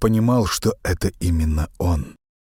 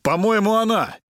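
higher, calling the others over; excited call. A narrator's reading. Narrator calls his friends to the found grave, excited. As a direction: hail them across the graves, eager.

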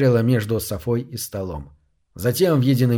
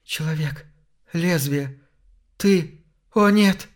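terrified whisper rising to a cry; шепот ужаса; 5 semitones higher, slower than usual. Acted. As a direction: start as a gasping whisper, then panic. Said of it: "Она снова шепнула". (шёпот, переходящий в испуганный вскрик)

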